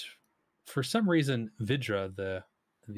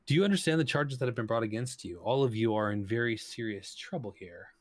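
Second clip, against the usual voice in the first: stern Emir; 3 semitones higher, 3 dB louder than usual. Acted. The Emir Telesha warns the captured party of their serious charges. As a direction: lay down the law to the prisoners. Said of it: "Telesha says".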